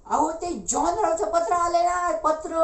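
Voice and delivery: high, breathless-with-news shout; excited high-pitched call; excited announcement. Acted. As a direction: blurt out the big news, high and eager. Boy breaks the news of letter.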